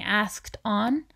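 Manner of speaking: plain reading voice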